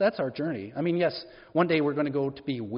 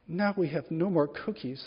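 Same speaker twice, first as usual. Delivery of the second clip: usual speaking voice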